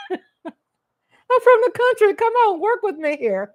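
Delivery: high, slow laughing words. Real, not acted. Laughing at herself with viewers, high and slow. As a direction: laugh through the line.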